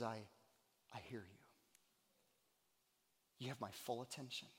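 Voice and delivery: very soft, low and intimate; quiet, attentive reassurance; hushed voice of God. Acted. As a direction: answer gently, almost whispering. Voices God answering softly.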